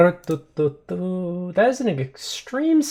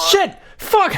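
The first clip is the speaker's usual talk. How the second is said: high, sudden curse outburst; alarmed swearing. Acted. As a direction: curse out as you get shoved. Character is being shoved out.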